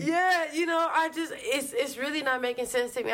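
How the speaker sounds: high incredulous complaint; exasperated. Real, not acted. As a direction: voice climbing in disbelief.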